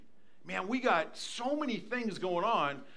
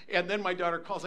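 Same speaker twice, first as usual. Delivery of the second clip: ordinary talk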